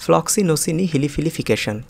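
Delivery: ফ্লক্সিনোসিনি হিলিফিলিফিকেশন run together quickly in one go. Shown as a demonstration. Teacher showing the quick version of ফ্লক্সিনোসিনি হিলিফিলিফিকেশন. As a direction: rattle off ফ্লক্সিনোসিনি হিলিফিলিফিকেশন briskly. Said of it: "একটু দ্রুত উচ্চারণ করছি".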